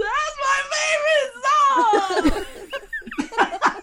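high-pitched mock sobbing wail; tearful wail. Puppeteer bursting into tears beside [name], hammed-up high wail. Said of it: "I burst into tears".

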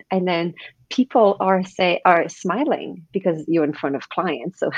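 normal talking voice

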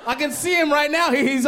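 mock scared warning. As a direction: warn the crew Barnaby is near.

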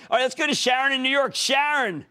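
high, brisk caller introduction; calling out the caller. Real, not acted. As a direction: summon the next caller with energy. Calls the next caller on air.

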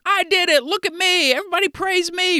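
put-on high, showing-off kid voice; little brother. Builders bragging like the little brother, high kid voice. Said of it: "like your little brother saying".

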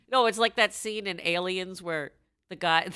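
normal talking voice

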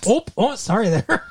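hammed-up Midwestern apology; Michigander accent. Co-host teasing local speech, mock Michigander accent. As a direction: exaggerate a polite Michigander accent. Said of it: "a sexy, um, uh, Michigander accent".

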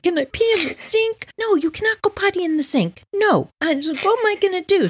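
put-on very high childish voices; high-pitched kid voices; little kids panicking. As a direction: squeak like tiny kids in a panic. Kids panicking over potty.

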